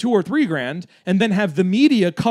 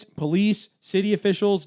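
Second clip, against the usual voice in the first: ordinary talk, nothing marked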